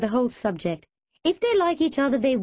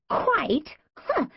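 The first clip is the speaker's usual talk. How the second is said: high scornful snort; mock disbelief, pitched up; 9 semitones higher than usual. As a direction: scoff at the word. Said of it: "Jazz snorted".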